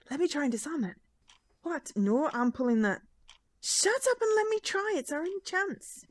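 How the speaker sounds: put-on Scottish accent. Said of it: "I'm just doing the Scottish accent".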